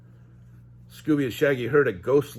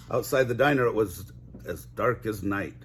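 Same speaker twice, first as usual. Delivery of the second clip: usual speaking voice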